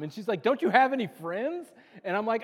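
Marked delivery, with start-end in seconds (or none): 0.4-1.7 higher, louder mock jab from the wife; hammed-up wife's teasing question. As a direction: tease him like an exasperated wife. Wife teasing him in retold story.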